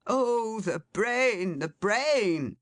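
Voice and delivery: high, slow sanctimonious lament; mock-pious lament. A narrator's reading. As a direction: lament with pompous piety. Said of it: "exclaimed the pious Alderman".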